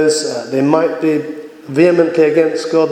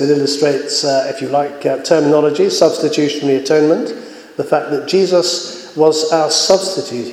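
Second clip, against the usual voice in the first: usual speaking voice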